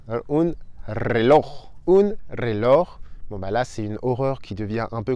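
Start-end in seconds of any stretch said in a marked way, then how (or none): 0.1-2.9 reloj slowly, rolled initial r and throaty j; slow model word. Shown as a demonstration. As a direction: stretch out un reloj. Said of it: "Autre mot horrible".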